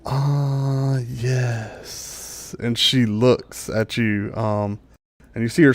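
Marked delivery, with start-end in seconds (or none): 0.1-2.6 long, sighed-out dryad voice; unhurried dryad. Acted. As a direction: stretch it slowly and let it fade. Game master voices the dryad.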